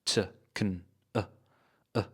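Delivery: "T, kun, uh" as tiny reduced schwa syllables; unstressed grammar words. Shown as a demonstration. Teacher listing grammar words, short unstressed weak forms. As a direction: drop each to a brief, low "tuh, kun, uh". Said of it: "that are unstressed".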